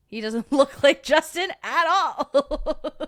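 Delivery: high, loud, slow laughing protest. Real, not acted. Showing chat her husband's photo, laughing hard. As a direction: dissolve into laughter as you speak.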